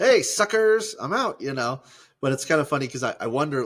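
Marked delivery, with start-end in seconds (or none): 0.0-1.4 loud, high-pitched playful jeer; cheeky taunt. Acted. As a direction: toss a mocking goodbye over your shoulder. Acting Jesus's cheeky exit.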